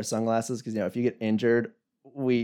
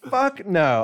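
flat refusal; 8 semitones higher than usual. Retold or imitated. Emphatic refusal, retold.

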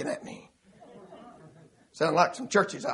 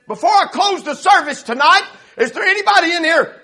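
raised, loud pulpit call; preacher addressing congregation; 10 semitones higher, 8 dB louder than usual. Retold or imitated. Young preacher asks congregation about Bibles, loud pulpit call. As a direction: call it out to the whole room.

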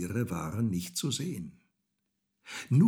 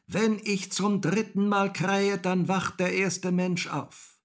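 high, sustained crowing voice; rooster calling down; 11 semitones higher, 5 dB louder than usual. A narrator's reading. Rooster explains to Häwelmann below, calling down. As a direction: proclaim it high from the tower.

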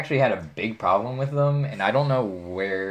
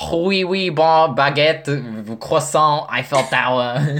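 high, slow hammed-up French accent; playing a cartoon Frenchman in a French accent; mock French accent; 5 semitones higher, 5 dB louder, slower than usual. Showing friends her fake French, a hammed-up French accent. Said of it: "I'm gonna speak French for you guys".

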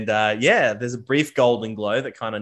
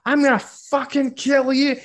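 much higher, louder, slow enraged roar; a dwarf stabbed in the arm roars revenge; furious threat; 14 semitones higher, 5 dB louder than usual. Acted. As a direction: lose it completely and threaten to kill. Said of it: "he starts to lose his fucking mind".

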